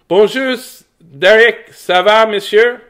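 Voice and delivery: loud, high playful French hello; mock French greeting. Greeting Derek the watcher, in hammy French. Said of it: "That's all I know in French".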